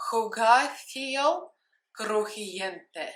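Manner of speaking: "Jugacio" drawn out very slowly, rising, with a hard k; careful Spanish pronunciation. Shown as a demonstration. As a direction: say "Jugacio Crujiente" slowly, syllable by syllable. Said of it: "I hope I did it right now".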